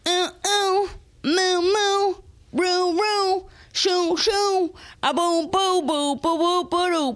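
high squeaky doll babble; mechanical crying doll. Acted. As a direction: babble like a wind-up talking doll.

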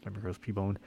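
ordinary delivery

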